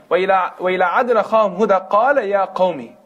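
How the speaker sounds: fast, melodic Quran chant; Quranic recitation. Acted. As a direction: recite the verse in a chanting flow. Quran verse opens the lecture.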